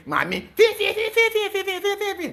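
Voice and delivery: very high mocking squeak, fi fi fi; high-pitched mock chatter of shrine mediums; squeaky animal noises. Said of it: "talking like that".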